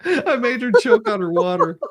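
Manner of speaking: high, giggling words through laughter; laughing hard. Real, not acted. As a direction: speak while cracking up. Laughing about witness choking.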